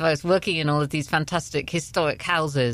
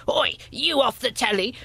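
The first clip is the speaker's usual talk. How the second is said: high-pitched mock heckle from a van; imitated shout; 8 semitones higher than usual. Van driver hailing a TV presenter, imitated shout. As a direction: call out like a man hailing a celebrity. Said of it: "he shouted out".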